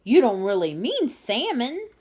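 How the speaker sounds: high, loud squawking; thrilled disbelief. A narrator's reading. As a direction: screech in disbelief. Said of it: "screeched the pelican".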